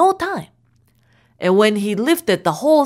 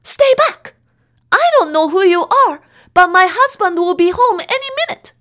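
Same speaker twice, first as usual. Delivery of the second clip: frightened; 8 semitones higher, faster than usual. A narrator's reading. Frightened wife facing stranger.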